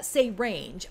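usual speaking voice

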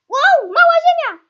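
loud, high squeal of surprise; excited shout. Real, not acted. Gamer spots a small earth, excited shout. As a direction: shout high with amazed delight.

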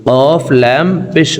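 normal talking voice

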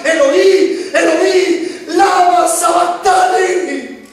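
high, slow anguished call; Jesus calling out on the cross, high and slow; cry from the cross. Retold or imitated. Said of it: "escuchar gritar".

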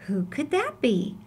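ordinary delivery

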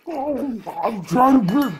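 dog voice through a mouthful; mouth full. Pretend. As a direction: talk round a toy in mouth. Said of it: "talk with your mouth full".

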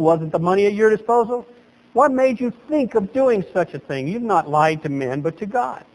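ordinary delivery

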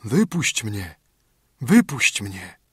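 a slightly muffled, hollow plea; lightly voiced as if from underground. A narrator's reading. Voice under the oak begs to be freed, muffled. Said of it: "bardzo głuchym tonem".

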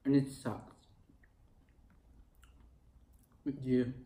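chewing while talking; licking fingers between words; mouth full. Real, not acted. Seen 1: forkfuls eaten before; lips pressed chewing, then fingers in mouth.

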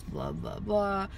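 normal talking voice

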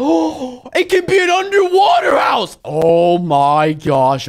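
high, loud burst of inspiration; excited idea. Real, not acted. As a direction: shout as the idea hits. Gets the underwater house idea.

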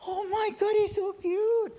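loud falsetto coo, drawn out; mock cutesy gush; gushing new owner. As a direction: squeal adoringly, very high and overdone. New owner gushing over dog.

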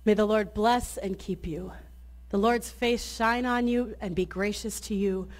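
ordinary delivery

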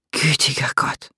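whispered under the breath; horrified murmur. A narrator's reading. Jem murmurs at the twitching woman, whispered in horror. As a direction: breathe it out in shock. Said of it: "murmelte Jem".

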